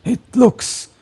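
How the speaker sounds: put-on high, questioning old priest; wary priest. Priest questioning the stranger's unfamiliar symbol. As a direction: doubt the stranger's holy symbol. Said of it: "he kinda looks at you".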